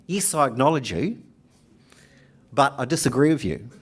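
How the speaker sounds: ordinary talk, nothing marked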